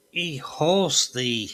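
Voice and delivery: normal talking voice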